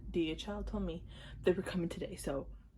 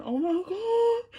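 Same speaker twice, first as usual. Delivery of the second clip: much higher, louder and slow squeal; squealed oh my god; 13 semitones higher, 8 dB louder, slower than usual. Real, not acted. Fan sees a popular photo card, slow high squeal. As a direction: stretch it into a squeal.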